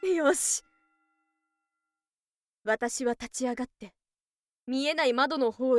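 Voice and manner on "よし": higher, punchy victory shout; triumphant cry. Acted. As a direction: celebrate the win. Victory after choking him out.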